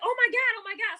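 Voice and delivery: high mock panic as Queen; Queen panicking at the news; panicked imitation. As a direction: squeal it like a frightened girl. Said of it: "Queen like".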